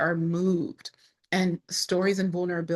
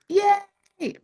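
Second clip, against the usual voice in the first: high, loud happy yay; excited cheer; 6 dB louder than usual. Real, not acted. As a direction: cheer at finally remembering. Finally remembers the name.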